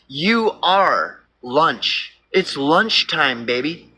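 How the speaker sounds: very slow, drawn-out menace; slow mock wolf taunt; predator threat. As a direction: savor each word like a hungry wolf.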